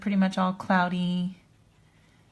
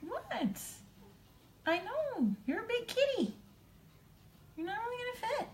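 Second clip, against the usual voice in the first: high cooing pet talk, softer; cat lady voice; 8 dB quieter than usual. Real, not acted. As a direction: coo at your big cat like a doting cat lady. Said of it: "you hear her sound like a cat lady".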